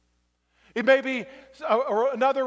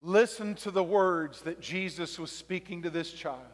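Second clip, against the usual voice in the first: soft altar call; 6 semitones lower, 7 dB quieter than usual. Acted. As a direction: draw them inward gently. Quiet reflective altar call.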